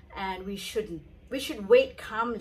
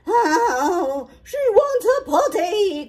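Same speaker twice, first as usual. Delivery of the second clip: high, loud cry; the gardener calling out in alarm; panicking gardener; 10 semitones higher, 10 dB louder, slower than usual. Acted. The gardener shouting the news, high and loud. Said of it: "cried the gardener".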